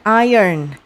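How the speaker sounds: "iron" said "AI-yern"; correct pronunciation. Shown as a demonstration. Teacher correcting the misreading for pupils, "iron" as "AI-yern". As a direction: say "iron" with the R after the vowel, "eye-ern". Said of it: "Ini bacanya bukan iron".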